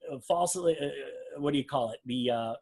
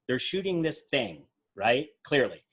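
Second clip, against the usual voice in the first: ordinary talk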